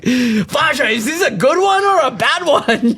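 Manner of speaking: high-pitched mock kid voice; confused child. Confused mixed German-Indian kid asks a parent about the swastika, high childish voice.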